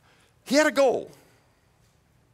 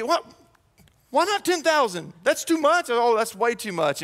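higher, indignant mock haggling; mock local protest; 5 semitones higher than usual. Local shocked at a lowball, high indignant voice.

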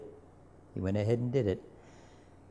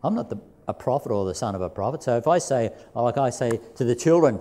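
usual speaking voice

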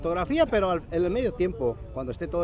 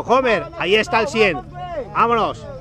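louder and higher, building urgency; excited play-by-play; 6 semitones higher, 9 dB louder than usual. Real, not acted. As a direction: warn of the danger, building. Live play call, rising.

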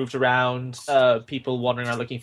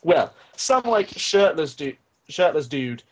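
ordinary delivery

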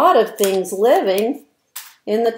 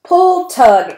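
louder, higher, straining pull; effortful pull; 8 semitones higher, 6 dB louder than usual. A narrator's reading. Acting out ants hauling food, straining and loud. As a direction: heave the words like ants hauling.

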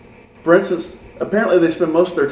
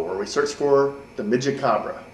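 ordinary delivery

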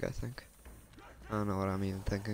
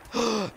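sharp gasp; 12 semitones higher, 7 dB louder than usual. Real, not acted. Sudden scare in game.

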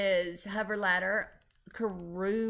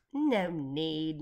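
ordinary delivery

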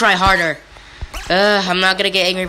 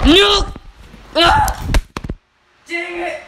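frustrated scream; 12 semitones higher, much slower than usual. Real, not acted. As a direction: scream at the game. Fails again in game.

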